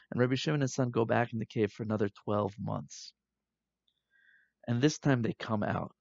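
ordinary talk, nothing marked

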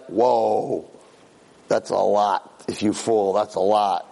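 low, awed murmur of doubt; daunted friend. Acted. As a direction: act out a friend taking in the drop. Friend looks down the canyon.

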